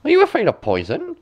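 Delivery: high, quick teasing crone; high mocking crone; old woman. A narrator's reading. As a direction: needle her like a sly hag. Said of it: "said the old woman".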